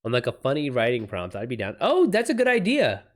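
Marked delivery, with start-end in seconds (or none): 1.8-3.0 bright, high burst of enthusiasm; light up at the idea; pitched-up delighted reaction; excited. Real, not acted. Excited reaction to collab suggestion.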